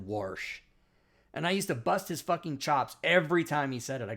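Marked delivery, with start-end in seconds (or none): 0.1-0.6 "Warsh" with an r before the sh. Retold or imitated. Quoting army buddy from Spokane, "Warsh" with an added r. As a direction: say wash as "Warsh".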